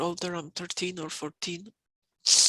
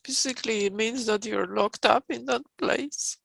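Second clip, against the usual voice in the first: higher, louder, rising protest; distressed outburst; 4 semitones higher, 10 dB louder than usual. Real, not acted. Client protesting being locked up, rising higher and louder. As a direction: push the words out in distress. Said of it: "emotional outburst".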